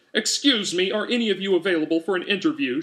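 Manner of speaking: high, eager squeak, rushed; high, fast, timid squeak. A narrator's reading. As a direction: pipe up on tiptoe over the crowd. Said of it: "she squeaked".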